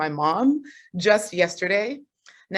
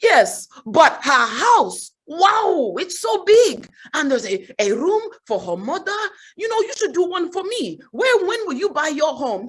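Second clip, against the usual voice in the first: high, hammed-up Nigerian mom impression; Nigerian accent; 9 semitones higher, 4 dB louder than usual. Mom derailing daughter's good news with cousin's house, exclaiming in Nigerian accent. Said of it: "about to do the accent".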